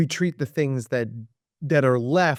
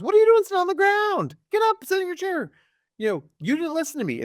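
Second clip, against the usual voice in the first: put-on much higher, quick teacher telling off a child; scolding adult; faster than usual. Teacher scolds a child for sitting on the ground, scolding. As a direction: act out an exasperated teacher's high-pitched reprimand. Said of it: "the teacher or the adult goes".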